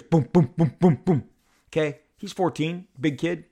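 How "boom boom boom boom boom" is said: loud booming footsteps, each word a heavy thud; imitated stomping. Dad mimics son stomping up the stairs, loud thuds. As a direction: stamp out each boom like feet on stairs. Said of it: "Luke stomps up the stairs".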